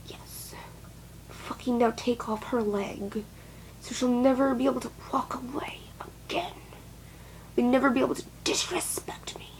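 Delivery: a creepy whispered voice egging on cruelty; menacing whisper. Retold or imitated. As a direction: hiss it like a villain plotting. Voicing a cruel inner voice.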